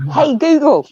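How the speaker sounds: calling a voice assistant. Shown as a demonstration. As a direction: summon the smart speaker, loud and high. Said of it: "Now you gotta go".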